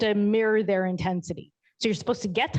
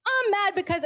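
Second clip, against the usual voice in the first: put-on toddler voice; 13 semitones higher than usual. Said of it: "And then he goes".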